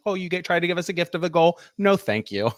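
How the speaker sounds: lightly acted polite turning-down; mock-polite refusal. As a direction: play at courteously declining a gift. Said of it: "politely declined".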